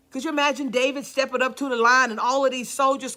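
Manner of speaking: ordinary talk, nothing marked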